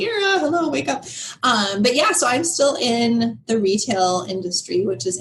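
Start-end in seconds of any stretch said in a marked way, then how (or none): 0.0-1.0 teasing imitation of a wake-up call; mock wake-up call. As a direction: imitate someone trying to rouse her. Voices God calling her to attention.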